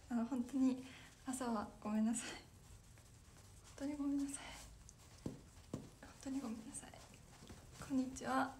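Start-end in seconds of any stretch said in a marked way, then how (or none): 5.2-6.9 a hushed, breathy repeat of the sorry; whispered apology. Real, not acted. Streamer whispering sorry to viewers for the morning. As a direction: whisper the apology again as if confiding.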